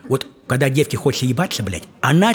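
ordinary delivery

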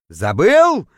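loud incredulous echo, swelling upward; outraged roar. A narrator's reading. Poisoned diver to the forgetful guide, outraged roar. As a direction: throw the word back in outrage. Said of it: "взревел, точнее, даже завыл я".

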